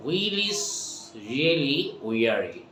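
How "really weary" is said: normal talking voice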